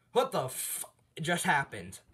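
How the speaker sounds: loud, high burst of shock; stunned outburst. Retold or imitated. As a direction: blurt it out loudly in mock disbelief. All Might stunned after the scene.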